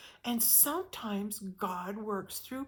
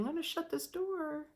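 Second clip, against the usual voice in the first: softly and higher, God closing a door kindly; gentle voice of God; 7 semitones higher, 4 dB quieter than usual. Acted. God gently closing a door on the listener, soft and high. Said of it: "God very gently saying".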